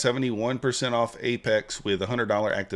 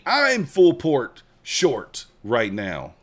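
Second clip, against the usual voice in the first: slow and louder, every word leaned on; say it with your chest; 3 semitones higher, 5 dB louder, much slower than usual. Shown as a demonstration. Showing Tom how to announce his trade, slow and louder. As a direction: declare it like you really mean it. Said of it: "You gotta say it with your chest".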